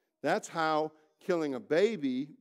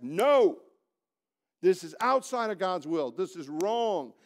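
loud, hard, slow no; firm refusal; 4 semitones higher, 6 dB louder, slower than usual. Shown as a demonstration. Preacher models refusing sin, hard and loud. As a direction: slam the door on temptation.

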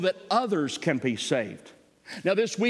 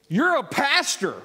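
loud, high exclamation, slowed down; retold shriek; 9 semitones higher, 5 dB louder, slower than usual. Lady recognizing a pastor on the plane, mock shriek. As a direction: imitate a startled woman crying out. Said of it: "she screamed. She said".

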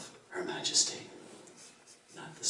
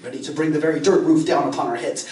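usual speaking voice

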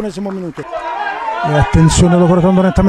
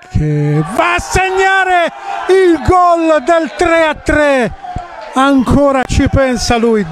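excited goal call; 8 semitones higher, slower than usual. Real, not acted. Goal called in commentary.

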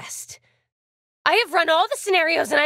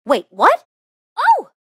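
taken aback, then a sudden high gasp of realization; startled surprise; 3 semitones higher, 4 dB louder than usual. Acted. Game character reacts in surprise.

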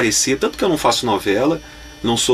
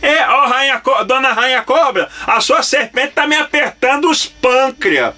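much higher, slow goofy cartoon voice; silly cartoon voice; 11 semitones higher than usual. Voice actor repeating his dubbing line for the director, goofy and high. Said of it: "o que que você falou aí?".

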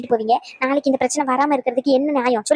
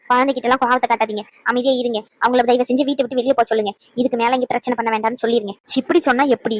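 ordinary talk

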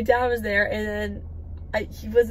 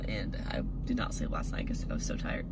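whispering; 14 semitones lower, 8 dB quieter than usual. Real, not acted. Tired mumble.

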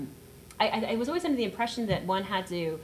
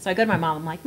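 ordinary talk, nothing marked

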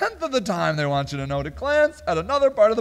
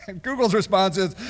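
ordinary delivery

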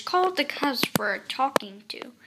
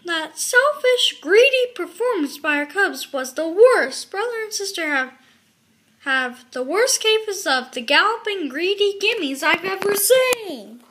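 loud, heated father's tirade; high, forceful scolding; outraged roar; 5 semitones higher, 8 dB louder than usual. A narrator's reading. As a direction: rant with mounting indignation. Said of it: "he roared".

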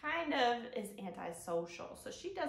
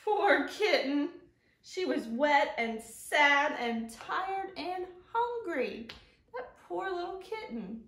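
slow, louder, sympathetic storybook voice; reading to children; 6 semitones higher, 6 dB louder, slower than usual. Acted. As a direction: read it like a sad picture book for little ones.

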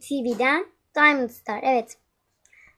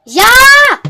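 high, loud scream; shriek; 11 semitones higher, 15 dB louder than usual. Acted. Daughter screaming when she wakes late, high shriek. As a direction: let out a piercing yell.

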